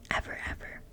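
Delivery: usual speaking voice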